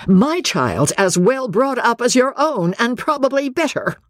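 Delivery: high-pitched, indignant retort; stung mother standing her ground; defensive. Acted. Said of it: "Parthy turned defensive".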